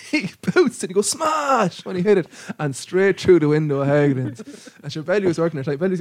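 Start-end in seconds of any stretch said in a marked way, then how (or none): 1.1-1.8 high, drawn-out comic "smash"; mock exclamation. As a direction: act out the moment of impact, pitched right up. Retelling ball smashing window.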